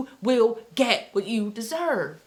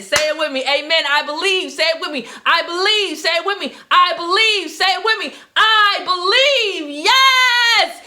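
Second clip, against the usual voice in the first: higher and louder climbing to a cry; building preacher shout; 7 semitones higher, 7 dB louder than usual. Acted. Call and response building.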